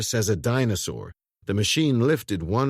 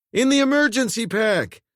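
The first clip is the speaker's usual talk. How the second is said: loud, high call; urgent shout; 13 semitones higher, 5 dB louder than usual. A narrator's reading. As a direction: shout it over the machine. Dermot shouting instructions.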